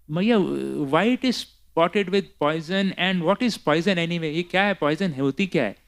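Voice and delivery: put-on high, curious child voice; little baby Krishna. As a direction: question mother like a puzzled small child. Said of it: "It's like a little baby".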